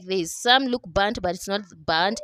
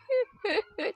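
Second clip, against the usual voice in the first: short laugh; 14 semitones higher, 6 dB quieter than usual. Real, not acted. Laughs after repeating word.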